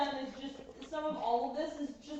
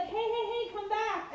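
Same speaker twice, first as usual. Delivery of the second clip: lightly voiced whiny puppies, higher; mock puppies begging her to return, higher; puppies calling; 8 semitones higher than usual. Puppies calling the owner back as she leaves, lightly voiced. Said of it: "they would be like".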